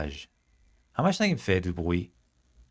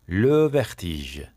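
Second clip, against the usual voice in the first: very slow and louder on le vertige; slow stretched le vertige; 5 dB louder, much slower than usual. Shown as a demonstration. Teacher stretches the last words so students catch them. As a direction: stretch vertige out for the class to hear. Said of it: "Ça me donne".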